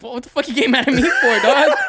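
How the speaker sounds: high, loud laughing retort; laughing mock outburst. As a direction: yell it while cracking up.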